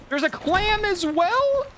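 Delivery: high, slow disbelieving question; incredulous dismay. Real, not acted. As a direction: squeal it slowly in disbelief. Another enemy spotted in game.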